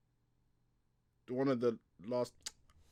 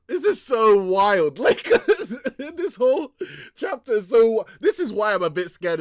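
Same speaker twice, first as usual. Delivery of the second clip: high, very loud excited outburst; laughing amazement; 10 semitones higher, 12 dB louder than usual. Real, not acted. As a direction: shout through laughter, blown away. Cracking up over wild chapter.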